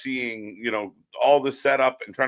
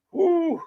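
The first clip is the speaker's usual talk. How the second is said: high woo. Real, not acted. Boston cheer outburst.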